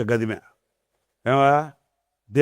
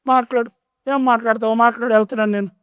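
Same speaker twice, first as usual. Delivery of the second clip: put-on much higher indignant old woman's outburst; angry old mother; 8 semitones higher, 4 dB louder than usual. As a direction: scold the pastor like a wronged old mother.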